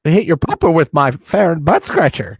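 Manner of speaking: high, loud silly alien; Jar Jar-style voice. Acted. Helmet wearer clowning for the co-host, high goofy alien. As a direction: squeal it out like a goofy creature. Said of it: "if you say it like that".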